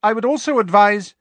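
loud, brisk advice; stern professor. Acted. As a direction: press on firmly until cut off. McGonagall raises voice, interrupted.

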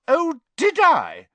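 high, loud, slow challenge; dangerously indignant. Acted. Hermione challenged by Ron, dangerously indignant. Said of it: "her eyes flashed dangerously".

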